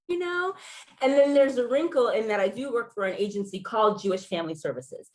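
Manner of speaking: ordinary talk, nothing marked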